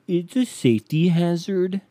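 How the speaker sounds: a hint of a whiny know-it-all; mock people quote. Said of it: "people are like".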